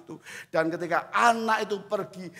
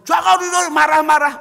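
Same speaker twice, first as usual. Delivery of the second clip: loud, high mock scolding; mother on the phone scolds him, loud and high; 10 semitones higher, 12 dB louder than usual. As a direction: fire it back like a mother on the phone.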